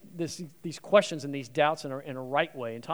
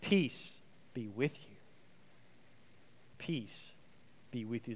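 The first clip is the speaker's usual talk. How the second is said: gentle blessing; 4 semitones lower, 10 dB quieter, slower than usual. Acted. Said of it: "No, he says".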